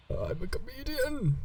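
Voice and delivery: weak, high, slow dying voice. Pretend. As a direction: play at being on your deathbed. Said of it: "My dying voice".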